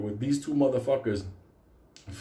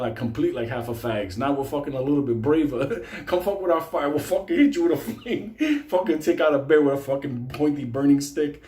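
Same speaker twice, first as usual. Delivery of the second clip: normal talking voice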